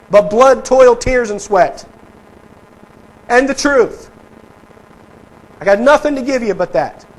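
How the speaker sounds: high, loud rallying cry; preacher channels Churchill loudly; Churchill paraphrased, rousing. Retold or imitated. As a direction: rouse the troops, full force. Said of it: "what does Churchill say".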